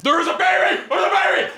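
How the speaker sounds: high-pitched frantic scream; shrieking in a sleep terror, very high. Retold or imitated. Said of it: "he is screaming over and over".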